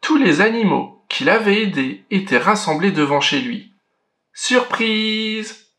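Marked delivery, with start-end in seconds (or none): 4.3-5.7 bright, higher cry of surprise; spring the surprise on your friend; excited cry. A narrator's reading. The animals greeting Georges at his door, excited. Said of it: "s'écrièrent-ils".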